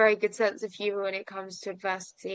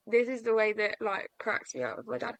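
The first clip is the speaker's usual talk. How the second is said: ordinary delivery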